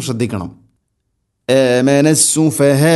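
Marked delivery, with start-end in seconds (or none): 1.5-3.0 "ആമന സുഫഹാ" chanted slowly, long final vowel closed by a light catch; long hold, light hamza. Shown as a demonstration. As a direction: stretch the end of "ആമന സുഫഹാ", then stop it gently. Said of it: "നീട്ടി നിർത്തുന്ന സമയത്ത് ഹംസയുടെ ഉച്ചാരണം". ("ആമന സുഫഹാ" നീട്ടി ലഘുവായ ഹംസയിൽ നിർത്തുക)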